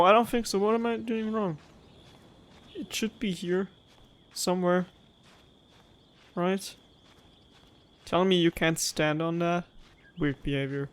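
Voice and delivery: ordinary delivery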